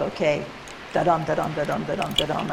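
"Shut up" and "da-dum" chanted as da-DUM with the weight on "up" and "dum"; iambic beat. Shown as a demonstration. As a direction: tap out the iambs, light then heavy on each "da-dum". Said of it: "It is iambic pentameter quite strictly".

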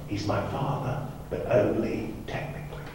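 soft breathy whisper, voice dropped away; whispered aside. Retold or imitated. Becca whispering in Blake's ear backstage, hushed. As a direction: lean in and whisper it behind the camera. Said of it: "Becca whispered in Blake's ear".

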